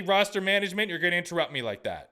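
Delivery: usual speaking voice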